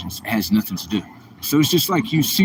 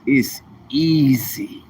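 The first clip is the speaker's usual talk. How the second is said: drawn out; much slower than usual. Shown as a demonstration. Repeating "it's easy" again.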